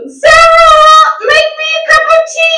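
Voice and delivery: fast, shrill-pitched British mum yelling for tea; British mum shout. Retold or imitated. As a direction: bellow like a demanding British mother. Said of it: "thick accent".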